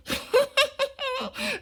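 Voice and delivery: high hammed-up villain laugh; wolf laugh. Wolf laughing at the double cross, high villain laugh. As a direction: cackle like a scheming wolf.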